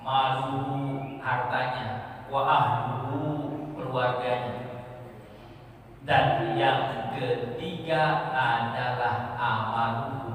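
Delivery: slow, measured hadith exposition; preaching recitation. Acted. Preacher explaining the hadith to the congregation, slow and measured. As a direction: draw out each Arabic word.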